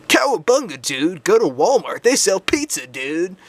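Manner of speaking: high, whooping cartoon surfer-dude voice; excited, swooping Ninja Turtle impression; cartoon turtle hyping up pizza; Michelangelo. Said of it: "my impression of a Ninja Turtle".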